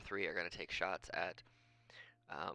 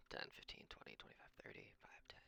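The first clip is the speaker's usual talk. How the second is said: whispered counting; 18 dB quieter than usual. Real, not acted. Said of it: "counting movement squares under breath".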